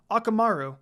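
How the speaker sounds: usual speaking voice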